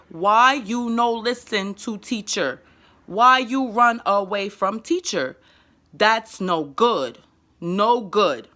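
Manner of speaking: slow, drawn-out broken English; slow right down so a child understands; slow simple child talk. Shown as a demonstration. Mother repeats it slowly when her son doesn't understand. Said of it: "if he's not understanding".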